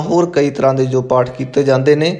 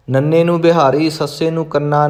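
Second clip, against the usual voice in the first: ordinary talk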